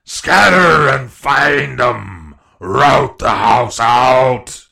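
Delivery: slow, very loud bellow; reiterated order. A narrator's reading. Blind pirate driving men to search, drawn-out bellow. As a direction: bellow it again, stretching every word. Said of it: "reiterated Pew".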